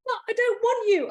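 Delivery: high whiny protest; scared boy. Acted. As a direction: plead like a frightened child. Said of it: "boy protests to fairy".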